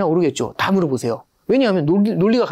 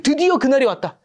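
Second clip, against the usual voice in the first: mock triumph; 8 semitones higher than usual. Investors finally seeing their day.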